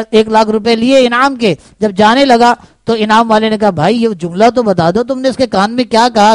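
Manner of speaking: ordinary talk, nothing marked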